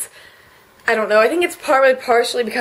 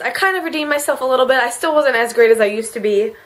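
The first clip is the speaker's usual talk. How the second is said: usual speaking voice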